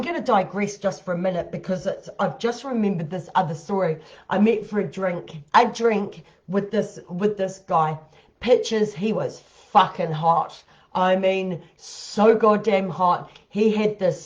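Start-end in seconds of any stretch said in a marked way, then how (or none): none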